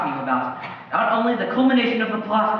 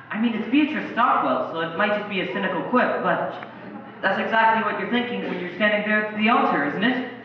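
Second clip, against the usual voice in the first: ordinary talk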